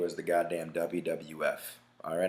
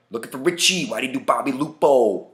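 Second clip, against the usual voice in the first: Seagal's hammed-up Italian-American tough guy, higher and louder; shitty Italian accent; 5 semitones higher, 8 dB louder than usual. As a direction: belt it out in a bad Italian accent. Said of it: "Shitty Italian accent".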